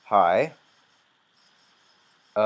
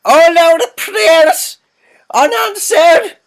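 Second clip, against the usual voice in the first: put-on much higher, louder, slow wailing old sister; funny high voice; 19 dB louder, much slower than usual. Gamer gives the sister a funny voice, high slow wail. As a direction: wail it in a silly falsetto. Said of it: "I'm gonna give this one a funny voice".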